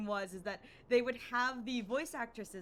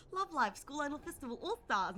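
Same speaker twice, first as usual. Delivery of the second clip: imitated Australian title call, higher; mock Australian announcer accent, pitched up; 4 semitones higher than usual. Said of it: "it's just in my brain".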